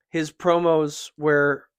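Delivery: usual speaking voice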